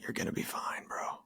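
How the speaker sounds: hushed, whispery mock reassurance; imitated whispered reassurance. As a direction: whisper it like a wise man soothing someone, in imitation. Retells Frankl reassuring his dad.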